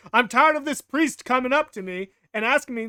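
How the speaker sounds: hammed-up irritated outburst; mock fed-up rant. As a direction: play an artist who has had enough. Acting out fed-up Michelangelo's rant.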